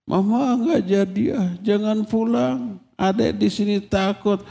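put-on child begging mother not to leave, pleading; mock wailing child. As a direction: plead like a scared child left at school, lightly acted. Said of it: "si anaknya menangis meraung-raung".

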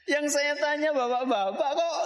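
high-pitched, amused objection through laughter; laughing protest. Real, not acted. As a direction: protest to the audience while laughing. Teasing audience after wrong side answered.

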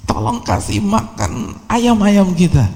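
hammed-up old mother calling her son; shrill old mother yelling. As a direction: squeak out the old mother's order. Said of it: "Teriak tuh ibunya".

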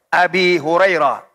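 "Abi Hurairah" with long i ending Abi; ya reading. Shown as a demonstration. Teacher listing case forms, "Abi" with long i. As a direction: stretch the final i in "Abi Hurairah". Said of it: "Ada kalanya pakai ya".

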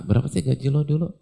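a careless boss brushing him off; mock stingy boss. The imagined stingy boss asks the worker, quick and dismissive. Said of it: "Dia nggak bilang".

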